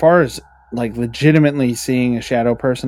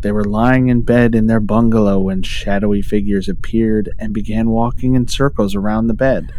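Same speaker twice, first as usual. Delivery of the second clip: louder, spooky storyteller read; ghost story voice; 5 dB louder than usual. Acted. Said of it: "his ghost story voice".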